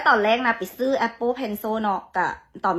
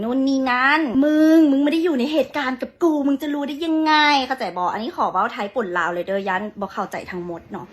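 ordinary talk, nothing marked

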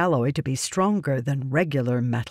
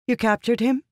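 hopeful; 7 semitones higher than usual. A narrator's reading. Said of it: "Yasna asked hopefully".

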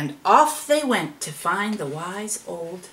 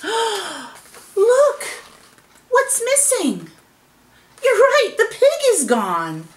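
high, excited exclamations; shocked discovery; 11 semitones higher, 4 dB louder, slower than usual. A narrator's reading. Animals finding the rock empty, high and shocked. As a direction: exclaim in mock astonishment.

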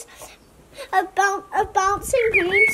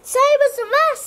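eager chorus; 6 semitones higher, 3 dB louder than usual. Acted. Fruits joining the feast.

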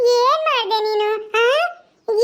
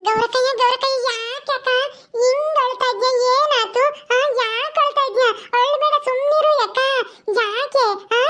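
put-on childlike Paddu, concerned. Paddu finds Gowrakka crying, childlike concern. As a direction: fuss over a crying elder sister in singsong concern.

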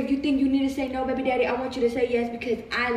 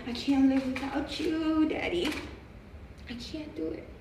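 soft pleading; 8 dB quieter, slower than usual. Real, not acted. Woman begging partner she can't live without, fading plea. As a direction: voice dropping softer as the plea goes on.

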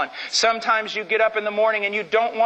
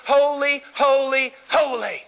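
seraph's cry; 7 semitones higher, slower than usual. Acted. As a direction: call out holy, holy, holy like the seraphim. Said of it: "and one cried unto the other".

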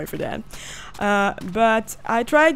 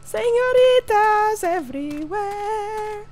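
1 mock Spanish; 9 semitones higher than usual. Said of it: "mock Spanish exclamation".

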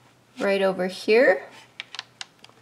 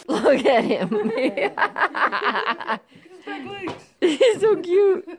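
high-pitched words through giggles; laughing fondly; 7 semitones higher, slower than usual. Real, not acted. Watching a wiggling puppy on video, laughing fondly. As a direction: giggle helplessly as you talk.

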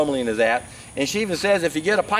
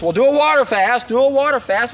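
higher-pitched mimicry of pushy advice; mock insistent; 6 semitones higher, 4 dB louder than usual. Imitating water-fast purists brushing off other methods, mock insistent. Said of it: "they insist".

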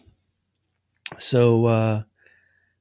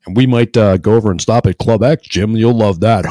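loud, acted-out project leader ribbing a coworker; retold loud teasing; 9 dB louder than usual. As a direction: call it out loudly, teasing.

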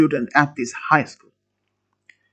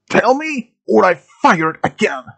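threatening demand; 5 semitones higher, 3 dB louder than usual. A narrator's reading. Gunman threatens student.

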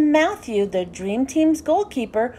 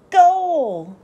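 high, louder shout of joy; excited cry; 4 semitones higher, 5 dB louder than usual. A narrator's reading. Narrator shouts as the team scores, excited. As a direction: call it like the ball just hit the net.

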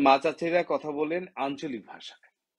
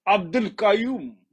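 আব্দুল কাইয়ুম in a louder, exaggerated Arabic accent; Arabic-style pronunciation; 8 semitones higher, 6 dB louder than usual. Imitating Arabic speakers, আব্দুল কাইয়ুম in a heavy Arabic accent. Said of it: "আরবি ভাষাভাষী আছে".

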